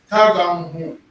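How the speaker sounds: Tagang Hu said loud and clear for the room; teacher repeats Tagang Hu loudly to the class. Shown as a demonstration. Teacher to class, repeating Tagang Hu loudly. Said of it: "You just say Tagang Hu".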